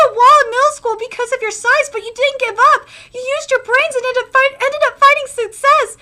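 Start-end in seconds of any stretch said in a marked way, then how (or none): none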